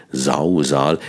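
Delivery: "Sausal" with both voiced s buzzing and stress on Sau; alliteration demo. Shown as a demonstration. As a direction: lean on the matching s sounds of "Sausal". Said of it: "Der Reim, der am Anfang".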